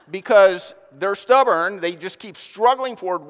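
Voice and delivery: ordinary talk, nothing marked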